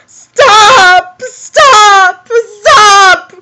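loud, high, drawn-out wail of protest; wailing disbelief, slow and loud; distressed outburst. Real, not acted. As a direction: cry out for it to stop. Overwhelmed reaction to the fic.

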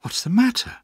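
high, worried question; alarmed cry. A narrator's reading. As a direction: ask urgently with pitch jumping up. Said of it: "I cried".

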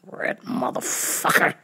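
whispered curse under breath; muttered insult. Retold or imitated. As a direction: hiss it so she can't hear. Muttered insult in retold reply.

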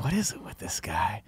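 whispered, breathy movie-star mutter; Michelle Rodriguez impression. A podcaster doing Letty, whispering to the hosts. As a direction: hush it like a smitten Letty. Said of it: "worst Michelle Rodriguez impressions".

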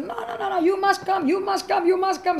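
high, urgent insisting, no no no; insistent pleading. Retold or imitated. Friend insisting she come for dinner, high and urgent. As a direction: press her hard to come.

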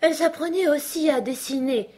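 high-pitched drowsy dormouse; yawning sleepy. A narrator's reading. Dormouse continuing while very sleepy, yawning. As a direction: carry on with a touch of sleepiness. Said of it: "poursuivit-il en bâillant".

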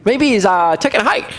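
high, teasing quip; mocking joke. Elijah joking to the priests that Baal went hiking. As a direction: toss off a sarcastic excuse. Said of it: "He's like".

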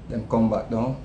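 low, soft mock nudge from the wife; lightly voiced wife calling him back to earth; calm wife's nudge. Wife taps his shoulder to snap him out of it, low and soft. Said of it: "She just tap me on my shoulder and say".

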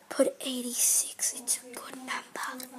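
secret whisper to everyone, very soft. Pretend. Car whispers a lucky number to the crowd, hushed. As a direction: slip the tip out under your breath. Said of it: "I heard that chick".

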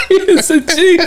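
high, breathless laughter; laughing. Real, not acted. Host praising his friend through high laughter. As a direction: shout it out between laughs.

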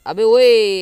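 long, loud, high-pitched holler; outraged shout. Real, not acted. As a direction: break off into a drawn-out yell at the attacker. Attacked during sign-off. (खींचकर ज़ोर से चिल्लाना)

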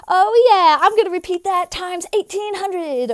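high, eager worship leader parody; gushing worship leader. Acted. Worship leader thrilled by a new bridge, gushing. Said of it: "Worship leader, heart eyes".

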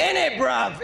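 put-on a light British accent on "In it, bruv"; mock British accent aimed at the UK rapper. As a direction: say "In it, bruv" as a London lad. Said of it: "killing it with the U K accent".

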